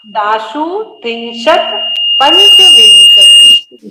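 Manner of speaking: तासु त्रिंशत पंचविंशति very slowly, word by word; careful recitation. Shown as a demonstration. Teacher demonstrating recitation, slow careful तासु त्रिंशत पंचविंशति. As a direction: separate तासु, त्रिंशत and पंचविंशति, stretching each. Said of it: "धीरे धीरे एक एक शब्द को".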